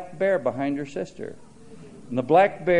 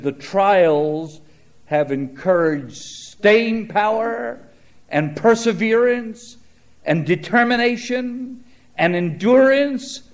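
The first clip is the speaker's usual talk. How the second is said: rising higher and louder, phrase by phrase; building fervour; 6 semitones higher, 5 dB louder, slower than usual. Acted. Preacher quoting Johnny's lesson to the congregation, building fervour. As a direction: swell into a preaching climax.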